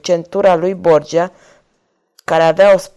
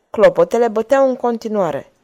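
ordinary delivery, nothing marked

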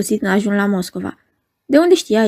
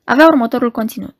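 usual speaking voice

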